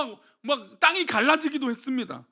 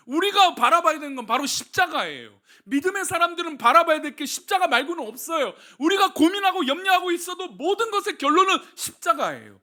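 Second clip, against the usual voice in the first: raised, louder preaching on the cross; fervent preaching; 5 semitones higher, 5 dB louder than usual. Acted. Pastor urging the congregation to look to the cross, fervent preaching. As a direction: press the point home, pulpit fervour.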